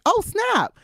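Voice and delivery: high, loud joyful exclamation; surprised shout. Retold or imitated. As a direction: shout with sudden delight. Retold own surprised joy.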